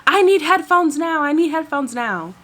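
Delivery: high, demanding mock yell; high-pitched retold yelling, easing off at the end; imitated screaming. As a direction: act out an impatient passenger calling out. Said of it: "she's, like, screaming".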